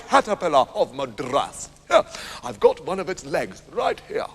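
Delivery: slow, relishing tall tale; pompous hunter with drawn-out key words; boastful hunter. Acted. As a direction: swagger through a heroic yarn, chuckling.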